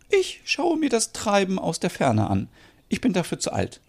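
high, soft voice, politely declining; reluctant mother Merit. Acted. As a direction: play an older mother bowing out gently.

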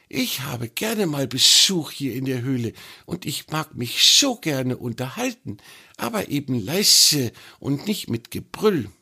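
put-on the snake teasing the troll about the old newt; chatty little snake. A narrator's reading.

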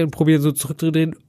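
normal talking voice

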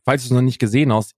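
ordinary talk, nothing marked

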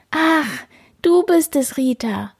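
high, relieved little cry; relieved kitten voice. A narrator's reading. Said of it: "ruft Karlchen erleichtert". (erleichtert, hohe Kätzchenstimme)

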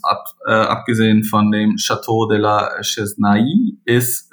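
normal talking voice

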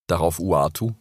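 ordinary delivery, nothing marked

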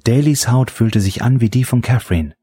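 ordinary delivery, nothing marked

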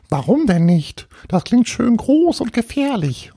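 very high-pitched tiny ghost voice; little castle ghost. Acted. Little ghost tells Paul where it lives, high ghost voice. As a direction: squeak it out as a small, self-assured ghost. Ghost defends its invented name.

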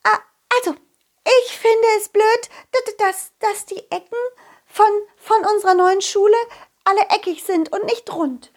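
squeaky little fairy, high and eager; an excited little fairy reading her list; high fairy child voice. Acted. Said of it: "Und dann legte sie los".

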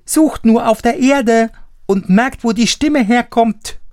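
high little eager voice; high piping offer; tiny Daumestick. A narrator's reading. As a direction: offer help like a tiny boy. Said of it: "antwortete er".

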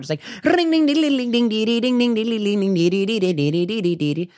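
very high rapid dinging; ding sound effect. Retold or imitated. Mocking the sped-up workout video with very high rapid dings. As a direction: mimic a bell going off fast.

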